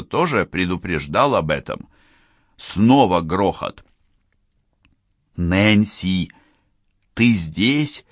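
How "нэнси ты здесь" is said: plain reading voice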